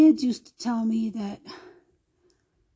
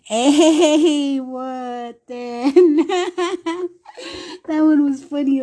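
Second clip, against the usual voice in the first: laughing; 5 semitones higher, 6 dB louder, much slower than usual. Real, not acted. Laughs at the story's end.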